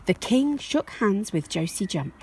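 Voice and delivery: plain reading voice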